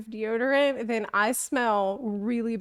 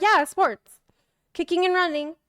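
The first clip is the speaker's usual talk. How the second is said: high, loud eager bluffing; flustered insistence; 7 semitones higher, 5 dB louder than usual. Retold or imitated. Vlogger bluffing to the nail lady, high loud flustered. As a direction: overeager flustered claim. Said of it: "I'm like".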